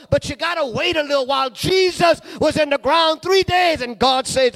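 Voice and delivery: climbing from steady to high, loud shout; building preaching. Acted. Preacher urging the church to wait on God, building to a shout. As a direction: build steadily until it bursts.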